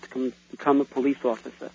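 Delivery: ordinary delivery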